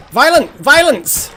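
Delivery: high, loud outburst; excited shout. Real, not acted. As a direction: yell it as the frogs attack. Frogs attacking in game.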